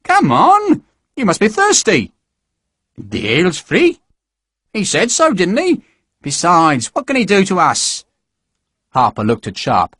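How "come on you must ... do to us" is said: higher, lightly voiced eager little recruit; eager small man. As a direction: coax them along cheerfully.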